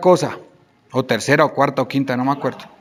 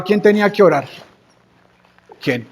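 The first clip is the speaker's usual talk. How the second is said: normal talking voice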